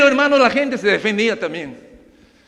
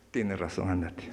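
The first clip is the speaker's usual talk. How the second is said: judge's low dismissal; 13 semitones lower, 14 dB quieter than usual. Acted.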